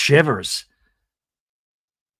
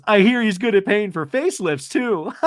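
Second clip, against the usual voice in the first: snarky talking-head imitation, slightly louder; 3 semitones higher, 5 dB louder than usual. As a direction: imitate a catty confessional jab. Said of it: "Snarky Talking Head".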